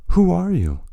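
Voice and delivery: a desperate cry with stress on 'who'; strangled and desperate. Acted. Overwhelmed president asks the stranger, stress on 'who'. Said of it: "his voice sounding strangled and desperate".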